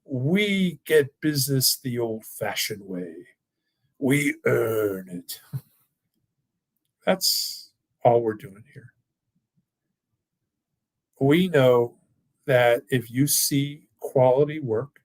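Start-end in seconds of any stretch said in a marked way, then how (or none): 0.0-8.9 put-on slow, grand British accent like an old TV pitchman; slow, stately British accent with a very drawn-out last line; British accent impression. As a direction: do John Houseman's British accent, each word weighed. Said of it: "he had this thick British accent, and he would say".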